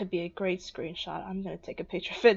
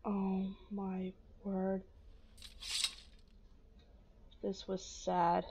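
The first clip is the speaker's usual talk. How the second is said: slow, much softer lament; hushed grief; 12 dB quieter, slower than usual. Real, not acted. Player mourning Astrid quietly and slowly. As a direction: let it fall away quietly.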